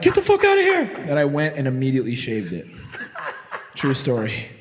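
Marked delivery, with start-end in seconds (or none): 0.0-0.9 very high, loud startled shout; shocked yell. Retold or imitated. Bum grabs his beard with bloody hands, high shocked yell. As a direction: yelp it as bloody hands hit your beard. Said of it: "And I was like".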